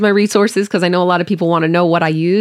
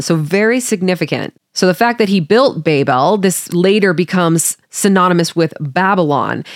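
ordinary talk, nothing marked

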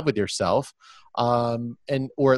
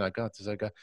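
quiet self-talk; 4 semitones lower, 13 dB quieter, faster than usual. Shown as a demonstration. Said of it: "I'm gonna try it out with myself".

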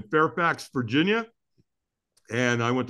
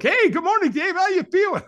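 much higher and louder, cheery mock greeting; chipper caller; 5 dB louder than usual. Man mimicking his AA friend calling to check on him, cheery high voice. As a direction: sing out a bright, overly cheerful good morning. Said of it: "He's like".

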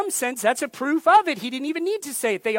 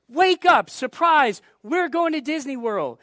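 louder, higher wake-up call; excited parents; 4 semitones higher, 3 dB louder than usual. Acted. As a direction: burst in to wake a child with a surprise. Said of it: "parents coming into the child's room".

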